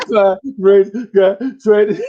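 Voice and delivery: hammed-up sobbing through the words, slow and high; imitated crying. Kid telling his teacher why he cries, mock sobbing. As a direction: blubber it like a bawling kid, half laughing. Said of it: "Now you look at me crying".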